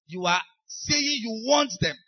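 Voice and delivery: ordinary talk, nothing marked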